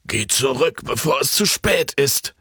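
lightly voiced old man pleading urgently; urgent warning. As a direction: warn him before it's too late. Old man warns Jan urgently.

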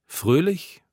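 FRÖHLICH? with a high rising tune; questioning. A narrator's reading. Narrator doubts his word for the drinkers, questioning fröhlich.

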